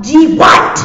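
loud, high irritated outburst; acted fed-up yell; exasperated. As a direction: snap back at the pest. Vegeta snaps at the nagging.

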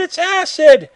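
high, loud shout of alarm; acted shouted cry. Character warning that it's acid, high acted shout.

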